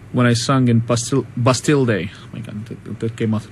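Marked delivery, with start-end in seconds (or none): 2.1-3.5 whispered aside. Real, not acted. Embarrassed at mispronouncing.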